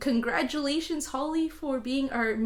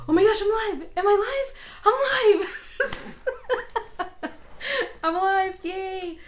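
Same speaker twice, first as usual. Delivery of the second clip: high, loud burst of surprised joy; shocked delight at going live; excited; 9 semitones higher, 5 dB louder than usual. Real, not acted. Realizes she is live on stream.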